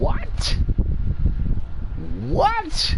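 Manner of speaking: a shrill, stunned double-take; incredulous what. Retold or imitated. Mocking people who cancel others, in high disbelief.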